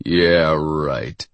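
low, sarcastic sneer. A narrator's reading. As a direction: let it sink low with scorn. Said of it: "the tall one sneered".

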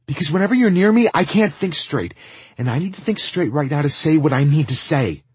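loud, high, agitated outburst; overwrought outburst. Acted. Lizzie can't think straight near Tristan, loud and overwrought. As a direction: let it burst out in desperation.

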